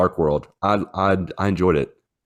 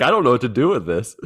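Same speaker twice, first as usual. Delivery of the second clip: baffled dog voice; 6 semitones higher, faster than usual. Retold or imitated. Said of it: "and Rayla's like".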